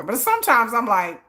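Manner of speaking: ordinary delivery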